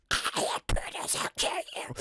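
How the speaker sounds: soft, breathy menacing whisper; whispered monster threat. Pretend. The dragon Poodles threatening the players, menacing whisper.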